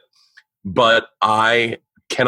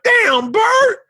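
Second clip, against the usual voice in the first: very high-pitched exclaimed impression; imitating a comedian friend, pitched way up; Patrice O'Neal impression. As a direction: call it out in a high, put-on voice. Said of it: "I do his voice".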